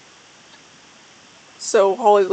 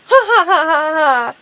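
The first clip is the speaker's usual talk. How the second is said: loud, higher cackling laugh; villain laugh; 4 semitones higher, 4 dB louder than usual. A narrator's reading. Holy Leaf gloating over Firestar, cackling. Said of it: "laughed Holy Leaf".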